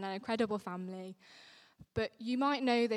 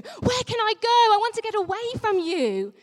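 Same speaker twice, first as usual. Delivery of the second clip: much higher, louder cry of Where can I go; panicked; 11 semitones higher, 11 dB louder, faster than usual. Shown as a demonstration. Vicar showing how David really cried out, panicked and loud. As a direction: blurt it out in panic, voice leaping up. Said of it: "I read those words in a, in a panic".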